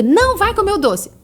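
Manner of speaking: harsh no. Shown as a demonstration. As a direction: snap a flat no at the child. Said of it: "é diferente".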